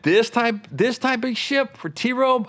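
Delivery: high, drawn-out disbelief; incredulous objection, acted out; retold incredulity. Retells questioning the booker's plan.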